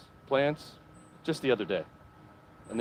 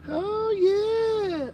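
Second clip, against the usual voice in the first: excited shout; 4 dB louder than usual. Real, not acted. Showing off gnome, shouting.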